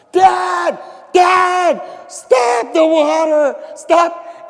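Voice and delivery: high, loud panicked shouting; sons screaming. Retold or imitated. Sons upstairs yelling down to Dad, panicked loud shout. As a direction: yell up frantically for Dad to stop. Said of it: "you could imagine the screaming".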